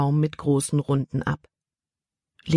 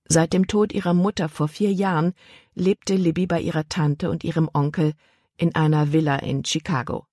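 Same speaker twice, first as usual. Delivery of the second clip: normal talking voice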